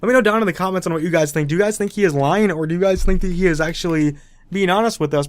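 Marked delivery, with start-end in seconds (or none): none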